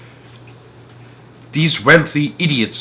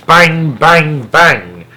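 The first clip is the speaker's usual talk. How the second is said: punchy bang bang bang, louder; mock gunshots; 5 semitones higher, 7 dB louder, much slower than usual. A narrator's reading. Dad mimicking the shooting of pheasants, punchy. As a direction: fire off each bang like a shotgun.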